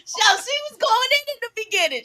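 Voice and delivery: ordinary talk, nothing marked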